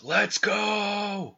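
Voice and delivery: high-pitched, drawn-out battle cry; Tom Brady's rallying yell. Retold or imitated. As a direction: imitate a quarterback firing up the team. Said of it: "how many times Brady yells".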